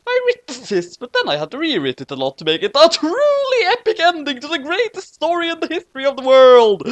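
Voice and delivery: high, excited bragging; hyped author's note. Acted. Author hyping readers about the grand finale. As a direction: oversell it like an epic.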